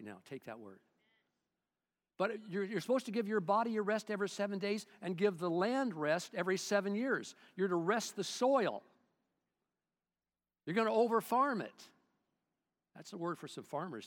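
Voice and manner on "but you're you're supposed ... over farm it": loud, driving preacher's voice; preaching at full force. Acted. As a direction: press the point hard on the congregation. Preaching on Sabbath rest.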